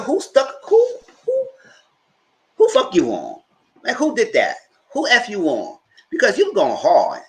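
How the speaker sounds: high, loud, incredulous grilling; mock-heated questioning. Host re-enacting a reaction to his outburst, incredulous. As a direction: playfully demand to know who it was.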